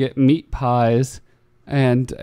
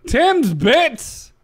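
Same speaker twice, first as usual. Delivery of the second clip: incredulous echo; 11 semitones higher than usual. Real, not acted. Incredulous echo of a silly name.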